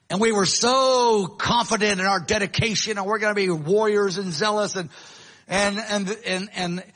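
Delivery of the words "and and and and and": normal talking voice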